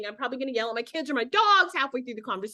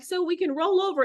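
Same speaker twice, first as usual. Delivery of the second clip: higher-pitched retold phone pitch; involuntary blurting; 7 semitones higher than usual. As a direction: blurt the forbidden word mid-pitch. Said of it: "that's what I was doing".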